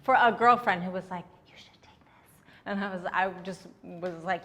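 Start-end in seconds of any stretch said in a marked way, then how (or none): 1.5-2.3 barely audible whispered urging; hushed, voiceless whisper; conspiratorial whisper. Retold or imitated. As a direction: whisper it like a secret dare. Girlfriend secretly urging her to steal.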